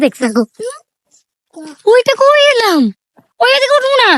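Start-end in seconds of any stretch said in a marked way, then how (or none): 1.9-4.1 high surprised questions; startled. Real, not acted. Vlogger suddenly unsure of the path, high and startled. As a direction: ask in high alarm where we are.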